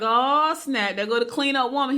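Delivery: loud, high burst of delight; retold excitement. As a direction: exclaim as if a favourite song came on. Retold delight at hearing favourite song.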